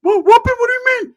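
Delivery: very high, loud cartoon squeak. [name] squealing at his cousin in a Mickey Mouse voice. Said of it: "from Mickey Mouse to [name]".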